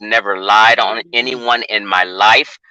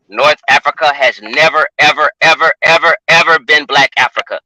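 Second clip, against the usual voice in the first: each ever hit a bit louder and higher; emphatic repeated ever; 3 semitones higher, 5 dB louder than usual. Real, not acted. Debater insisting on his argument to the caller, emphatic. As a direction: hammer every ever home.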